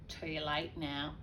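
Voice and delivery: plain reading voice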